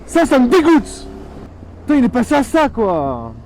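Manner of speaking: real angry outburst at a reckless rider; furious rant. Real, not acted. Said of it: "mon côté marseillais, il est ressorti direct".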